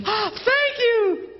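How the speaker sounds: high, slow, gushing thanks; gasp, then a drawn-out squeal of mock gratitude; delighted wife. Wife handed a dozen roses, mock gushing delight.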